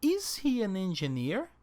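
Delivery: "Is he an engineer?" rising on "engineer"; rising question tune. Shown as a demonstration. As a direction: show the question rise clearly. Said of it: "remember the intonation for the interrogative".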